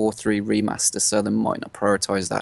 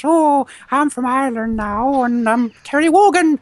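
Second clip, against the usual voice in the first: hammed-up Irish brogue impression; Irish Terry Wogan; 13 semitones higher, 8 dB louder than usual. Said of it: "and then we go into potatoes".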